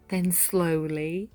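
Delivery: ordinary delivery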